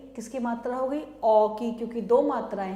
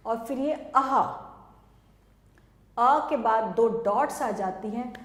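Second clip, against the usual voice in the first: usual speaking voice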